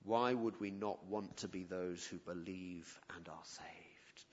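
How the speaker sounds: usual speaking voice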